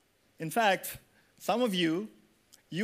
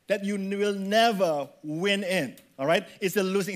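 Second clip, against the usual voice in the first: ordinary delivery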